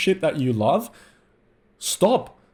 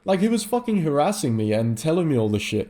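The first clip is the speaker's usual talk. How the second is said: ordinary talk, nothing marked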